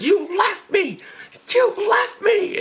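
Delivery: high-pitched, drawn-out mock yell; outraged accusation. Grabs brother, accusing him.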